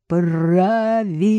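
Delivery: tongue-tied tipsy drawl; slurred drunk speech. A narrator's reading. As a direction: slur it like a drunk. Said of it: "заплетающимся языком проговорил".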